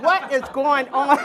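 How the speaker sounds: high, loud, laughing panic; panicked laughing cry. Retold or imitated. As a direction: throw it out high, half-laughing in alarm. Baby's head screaming during birth.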